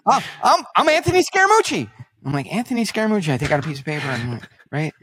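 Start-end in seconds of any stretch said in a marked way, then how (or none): 0.1-1.9 high, loud mocking imitation of a guest; flustered guest. Guest introduces himself to the host, flustered. 2.5-3.3 higher-pitched mock echo of the name; feigned puzzlement. Host pretends not to know the guest, feigned puzzlement.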